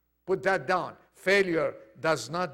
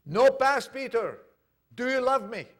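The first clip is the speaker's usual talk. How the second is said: high, mildly raised preaching voice; insistent questioning; 6 semitones higher, 4 dB louder than usual. Retold or imitated. Jesus asking Peter about love, insistent questioning. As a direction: put the question firmly, pitched up.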